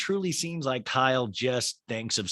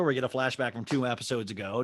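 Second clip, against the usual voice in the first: ordinary delivery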